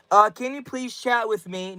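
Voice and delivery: ordinary talk, nothing marked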